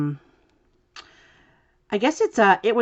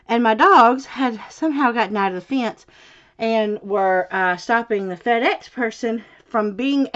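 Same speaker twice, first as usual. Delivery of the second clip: breathless and louder, pitched up; out of breath; 4 semitones higher, 3 dB louder than usual. Real, not acted. Telling viewers about chasing the dogs, still breathless. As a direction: gulp air while telling it. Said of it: "I'm a little out of breath".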